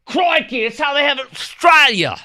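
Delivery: loud, high shouted mock Australian accent. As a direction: belt out an over-the-top Australian "Crikey". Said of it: "yeah, accent".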